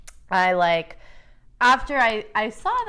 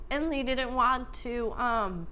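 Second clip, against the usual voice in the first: ordinary talk, nothing marked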